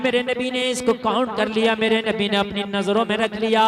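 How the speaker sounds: loud, ringing sermon delivery; fiery preacher's call; preaching at full force. Acted. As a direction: thunder it to the gathering.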